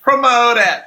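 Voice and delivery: high, drawn-out ceremonial proclamation; grand announcement. Pretend. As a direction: stretch each word out like announcing a prize winner. Announces promotion for a live gift.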